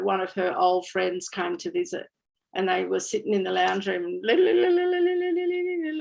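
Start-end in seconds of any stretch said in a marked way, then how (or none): 4.2-6.0 high rapid "li-li-li" babble; imitated language chatter. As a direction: imitate old friends chattering away in their language. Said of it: "like this".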